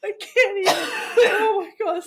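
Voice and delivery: can barely get the words out for laughing; helpless laughter. Real, not acted. Watching Ainsley burn from the sauce, cracking up helplessly.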